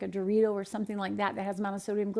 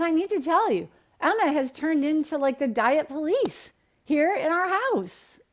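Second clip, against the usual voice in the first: higher, louder, emphatic caller; animated mother on phone; 6 semitones higher, 4 dB louder than usual. Retold or imitated. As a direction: report it like an exasperated mom. Voicing Emma's mother on phone.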